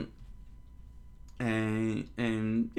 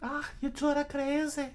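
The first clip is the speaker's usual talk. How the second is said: exasperated high falsetto; high, fed-up young woman; Nami. Acted. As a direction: groan at them in a put-on female voice. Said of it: "Nami said".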